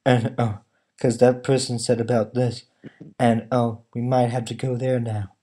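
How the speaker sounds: low, soft, monotone mock of the actors' lines; flat mumbling actors. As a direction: drone it out like lifeless dialogue. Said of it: "they all talk like".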